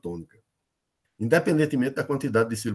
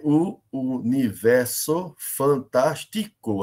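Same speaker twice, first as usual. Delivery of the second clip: very slow, every syllable of "universo fantástico" set apart; much slower than usual. Shown as a demonstration. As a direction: count out "universo fantástico" in separate beats, down to the final CO. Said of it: "nove sílabas gramaticais". ("universo fantástico" separado sílaba por sílaba, bem devagar)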